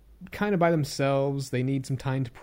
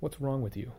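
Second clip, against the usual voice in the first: harsh self-scolding whisper, nearly voiceless; muttered self-criticism in a whisper; whispering to himself; 8 dB quieter than usual. Retold or imitated. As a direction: hiss the insult at yourself under your breath. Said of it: "I might whisper to myself".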